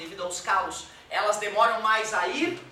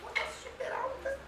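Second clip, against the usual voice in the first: much higher and softer, a quiet falsetto; high but soft; 14 semitones higher, 10 dB quieter than usual. Shown as a demonstration. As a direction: show a high note with little force. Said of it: "Dá pra falar baixo com voz alta?". (voz bem aguda e fraquinha)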